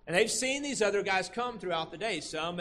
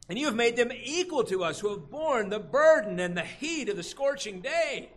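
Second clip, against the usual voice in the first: higher, louder aggrieved workers' voice; outraged complaint; 5 semitones higher, 4 dB louder than usual. Retold or imitated. Workers protesting the equal pay, outraged. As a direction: read the verse as the workers protesting. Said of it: "They're outraged".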